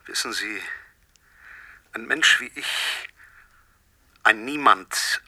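slow, weighed words; slow deliberate. Acted. Poisoner describes himself to the scientist, slow and deliberate. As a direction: lay each word down slowly.

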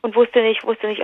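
ordinary talk

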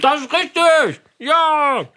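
loud, high cheer; excited. Real, not acted. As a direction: shout the win with joy. Host cheers the right answer.

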